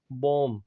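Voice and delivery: बॉम्ब said "bom", final b dropped; teacher voices बॉम्ब ending on m; silent b demo. Shown as a demonstration. Teacher shows class the silent b in बॉम्ब. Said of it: "ये last वाला बी हम नहीं".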